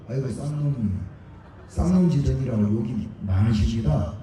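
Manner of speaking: bow and scrape sarcastically; mock fawning deference. Speaker mocks people honouring an old spy, sarcastic fawning. Mock polite praise of a lowlife.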